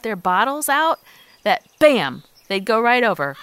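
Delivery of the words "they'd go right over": normal talking voice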